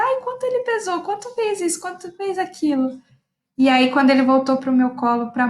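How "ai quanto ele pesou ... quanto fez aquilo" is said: eager questions. Retold or imitated. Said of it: "comecei a perguntar".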